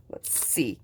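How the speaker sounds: soft unvoiced whisper. Real, not acted. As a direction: whisper it like a secret. Whispered peek into box.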